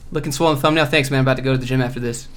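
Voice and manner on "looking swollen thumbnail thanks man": normal talking voice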